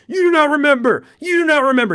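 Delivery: high, loud, booming god voice; a wrathful god shouting at a mortal; God yelling. Acted. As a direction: thunder it down as an angry deity. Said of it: "He's yelling at him".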